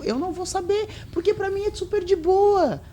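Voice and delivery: high-pitched, carefree whatever voice; high put-on voice, shrugging it off; acted breezy indifference. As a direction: play it airy and unbothered, way up high. Acting out her carefree posting attitude.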